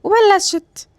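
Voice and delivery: normal talking voice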